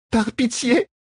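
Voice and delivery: high pleading woman's voice; under torture. A narrator's reading. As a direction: beg for mercy, high and strained. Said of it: "implora-t-elle".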